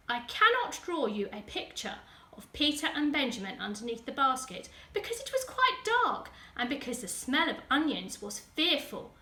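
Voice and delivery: plain reading voice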